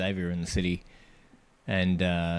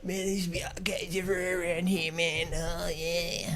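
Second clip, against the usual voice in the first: spaced-out stranger; 10 semitones higher, 4 dB quieter than usual. Retold or imitated. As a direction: play him as a dazed, rambling bloke. Voicing the stranger on the ledge.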